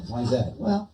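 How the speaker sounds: ordinary talk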